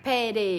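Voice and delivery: "Pede" crisp, short e's, falling end; Indonesian pede. Shown as a demonstration. Host repeating the Indonesian pede for viewers. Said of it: "pede sebutan dia".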